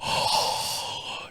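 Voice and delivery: sharp breathless gasp; excited gasp. Retold or imitated. Husband sees the Wendy's bag, excited gasp.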